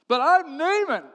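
loud, high, wounded pride; indignant protest. Acted. Naaman hearing his diagnosis, indignant. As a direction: protest as if your name exempts you. Proud man told of leprosy.

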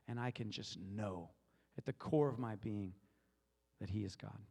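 low, intimate reflective voice; meditative musing. Acted.

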